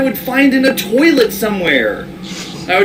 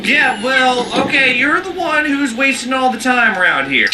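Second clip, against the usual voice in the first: ordinary talk, nothing marked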